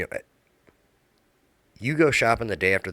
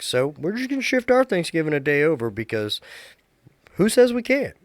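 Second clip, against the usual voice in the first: upbeat pep talk; 9 semitones higher, 5 dB louder than usual. Retold or imitated. Telling kids about moved Thanksgiving.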